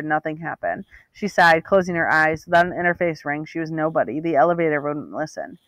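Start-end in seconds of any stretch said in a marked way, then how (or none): none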